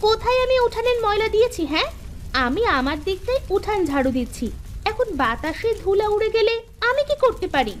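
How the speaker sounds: high, indignant protest; high defensive retort; indignant denial. Acted. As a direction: fire back at the accusation. Defending herself in the quarrel.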